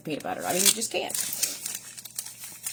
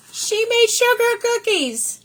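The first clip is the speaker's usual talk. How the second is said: much higher, louder and slow with delight; thrilled exclaim; 8 dB louder, slower than usual. Real, not acted. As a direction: burst out with happy surprise. Thrilled opening the cookie tin.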